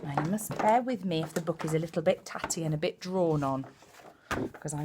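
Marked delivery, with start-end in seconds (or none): none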